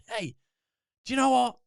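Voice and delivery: high, bursting with conviction; real conviction. Shown as a demonstration. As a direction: proclaim it like you truly mean it. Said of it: "say it with real conviction".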